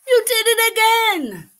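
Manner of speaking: high, loud exasperated groan; fed up with the interruptions. Acted. Papa exasperated.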